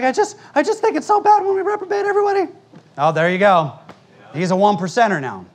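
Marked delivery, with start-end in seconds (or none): 0.0-2.5 high, fast mocking whine; mock him in a prissy put-on voice; whiny mimicry. Pastor mocks a former member's complaint in a high whine. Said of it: "he's like".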